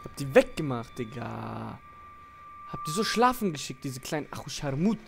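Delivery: usual speaking voice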